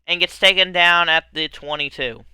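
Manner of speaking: loud excited sports call; play-by-play. Acted. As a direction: call the drive like an announcer. Play-by-play continues.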